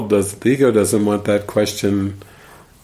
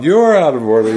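louder, higher mock courtroom shout; imitated movie defiance, pitched up; acted courtroom outburst; 5 semitones higher, 5 dB louder than usual. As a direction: throw it out as a raised-voice accusation.

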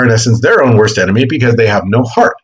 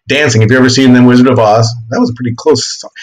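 ordinary talk

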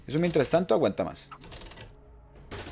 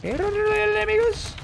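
falsetto. Pretend. Repite en falsete.

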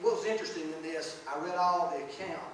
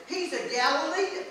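higher and louder, pointing him out; accusing tone; 8 semitones higher, 7 dB louder than usual. Retold or imitated. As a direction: call him out as an outsider. Accuser's line, retold.

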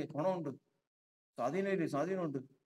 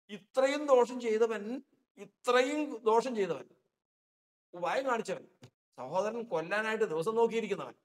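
higher, louder, building indignation; rising preaching; 6 semitones higher, 4 dB louder than usual. Real, not acted. Preacher to congregation listing Jacob's sins, loud and high. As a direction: pile up the charges with force.

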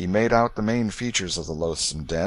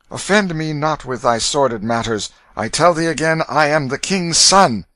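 put-on loud, raised, haughty boy; indignant young prince, defiant; 7 semitones higher, 6 dB louder than usual. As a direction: rebuke them with royal scorn. Narrator voices defiant prince.

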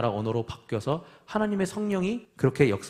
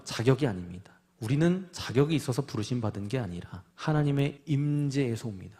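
usual speaking voice

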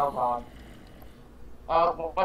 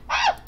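short, very high-pitched shriek; piercing high yelp of fright; panicked scream; 5 dB louder than usual. Real, not acted. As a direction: let out a loud startled shriek. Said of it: "Sorry, I screamed".

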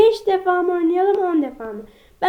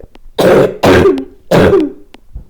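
cough; 2 semitones lower, 7 dB louder than usual. Real, not acted. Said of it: "Niye öksürüyorum acaba?".

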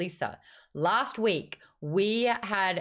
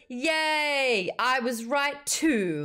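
gloating cheer; 5 semitones higher, much slower than usual. Real, not acted. Gloating about a correct guess.

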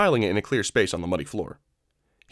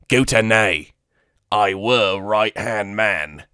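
slow, loud broken alien speech; nervous alien in broken English; Weequay voice; 4 dB louder, much slower than usual. Acted. Weequay prisoner answering.

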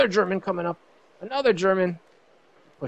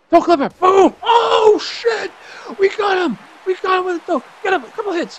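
screaming with joy, high and loud; triumphant victory screams; ecstatic shouting; 9 dB louder than usual. Real, not acted. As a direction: yell it like you just won the fight. Winning knockout in fighting game.

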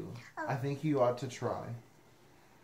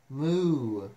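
long, louder mooing; cow moo; 5 dB louder than usual. Acted. Reader mimics a cow for the listener, mooing. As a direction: moo loud and drawn out like a cow. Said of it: "Can moo".

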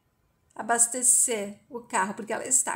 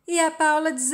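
plain reading voice

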